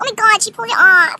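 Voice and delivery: loud, high dramatic complaint; hammed-up scandalized exclamation; mock outcry. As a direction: imitate a girl crying out in outrage. Repeats the acted outcry.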